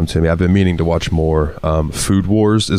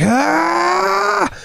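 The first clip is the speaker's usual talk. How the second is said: very high short yelp; high mock yelp. Retelling the movie hero's jump, a very high mock yelp. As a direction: squeal as if teleporting away. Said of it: "he just goes like".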